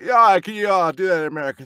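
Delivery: put-on loud, high American accent; brash mock American voice; mimicked American director. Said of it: "and he's like".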